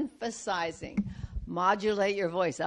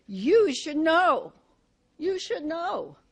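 You should know slow, higher and louder; strong point; 7 semitones higher, 6 dB louder than usual. Shown as a demonstration. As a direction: land You as a firm warning. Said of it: "you want to make a strong point".

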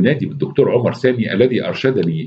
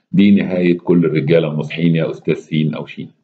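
plain reading voice

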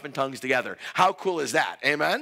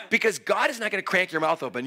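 usual speaking voice

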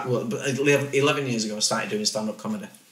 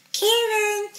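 put-on much higher childlike little-boy voice. Dad calls his baby son in a high little-kid voice. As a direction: call his name in a tiny, high kid's voice. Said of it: "I've always been able to do The Voice".

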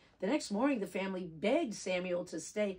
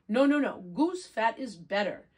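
louder, insisting she knows best; loud declaring; 4 semitones higher, 7 dB louder than usual. Acted. Grandma Sophie to Grandma Yetta, a loud insistence. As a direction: overrule her rival. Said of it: "declared Grandma Sophie".

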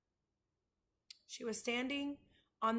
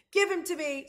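loud, raised-pitch yell; screamed demand; 6 semitones higher, 9 dB louder than usual. A narrator's reading. Abilene demands her rabbit from the boys, screaming. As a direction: yell it to get him back. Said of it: "screamed Abilene".

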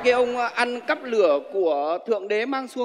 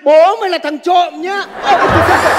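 higher, louder laughing yell; laughing shout; 7 semitones higher, 10 dB louder than usual. Acted. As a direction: yell it while cracking up.

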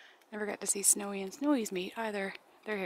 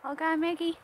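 much higher, louder sing-song coo; 9 semitones higher, 5 dB louder than usual. Real, not acted. Feeding a pet bird, coos.

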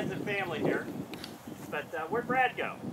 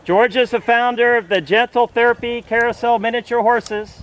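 booming tee-box introduction; announcer; 6 semitones higher, 15 dB louder than usual. Acted. Announcing golfer bio.

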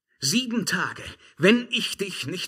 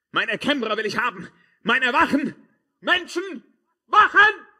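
rising to a loud, much higher shout; calling for guards; 10 semitones higher, 7 dB louder than usual. Acted. Emperor shouting for his guards in panic. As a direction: yell for help, more desperate with each word.

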